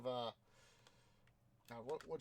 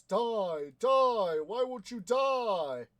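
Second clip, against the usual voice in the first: loud, high desperate yell, slowing on the last words; furious shout, much higher and louder; Creedy screaming; 8 semitones higher, 15 dB louder, much slower than usual. Retold or imitated. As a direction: scream it at someone who won't go down. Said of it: "he says".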